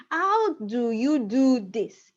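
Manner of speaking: slow, careful teacher reading; slow model sentence. Shown as a demonstration. Teacher slowly modelling the English question. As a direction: spell it out slowly for the class. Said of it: "If you put it in English".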